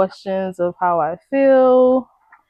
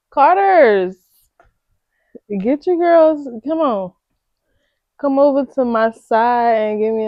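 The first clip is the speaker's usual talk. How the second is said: playful calling out; 2 semitones higher, 4 dB louder than usual. Pretend. Vlogger hailing Carter's for a sponsorship, playful call-out.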